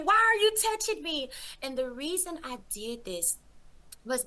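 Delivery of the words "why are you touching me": high, alarmed cry; shrieking question. Retold or imitated. Man shrieking at the Lord for touching him, high and alarmed. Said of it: "I shrieked back and I said".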